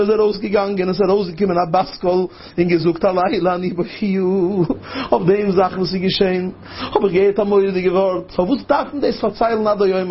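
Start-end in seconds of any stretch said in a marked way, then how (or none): none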